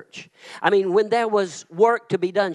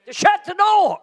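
higher, louder proclamation; retold urging; 11 semitones higher, 4 dB louder than usual. Pastor telling the woman to let go, shouting. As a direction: call it out like a preacher's command. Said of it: "I had the joy of telling her".

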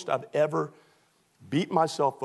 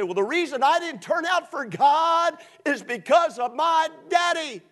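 whiny excuse maker; 12 semitones higher, 4 dB louder than usual. Retold or imitated. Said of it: "I have people all the time say".

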